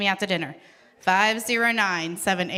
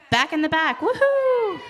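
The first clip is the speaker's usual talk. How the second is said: high-pitched celebratory whoop; excited cheer; 7 semitones higher, 3 dB louder than usual. Real, not acted. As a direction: cheer the winner with a high woohoo. Cheering a raffle winner.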